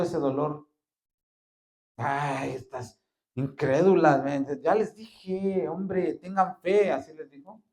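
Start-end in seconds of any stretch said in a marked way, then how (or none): 2.0-6.9 slow, drawn-out, rising chiding; Jesus tenderly rebuking the sisters' unbelief; Jesus gently chiding. Acted. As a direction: coax the doubters toward faith.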